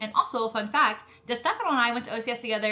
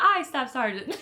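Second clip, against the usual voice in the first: higher, louder answer breaking into laughter; laughing mock reply; 10 semitones higher, 7 dB louder than usual. Candidate answers her scolding staff sergeant, amused mock obedience. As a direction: play the obedient candidate and crack up.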